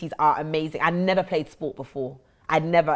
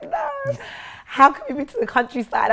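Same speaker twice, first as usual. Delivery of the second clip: incredulous; 11 semitones higher, 3 dB louder than usual. Retold or imitated. Retelling reaction to moving house.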